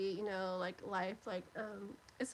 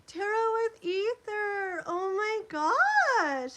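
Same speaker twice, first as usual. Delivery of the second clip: high, loud and slow with delight; thrilled recognition; 9 semitones higher, 9 dB louder, much slower than usual. Real, not acted. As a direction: stretch the name out, thrilled to see them. Recognises a viewer excitedly.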